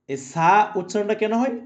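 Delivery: usual speaking voice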